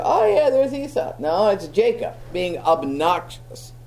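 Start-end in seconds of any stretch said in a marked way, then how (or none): 0.0-1.1 high-pitched imitation of a fooled father; mock recognition in a higher voice; blind dad. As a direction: play the duped old dad, pitched up. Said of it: "when his blind dad was touching him, he'd go".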